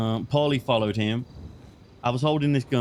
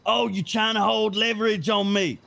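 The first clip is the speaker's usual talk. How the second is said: raised, mock-accusing voice for the angry Hassan; imitating Hassan; 8 semitones higher than usual. Retelling how Hassan called him out, imitating Hassan. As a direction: play Hassan catching you out, pitched up. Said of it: "And then Hassan was like".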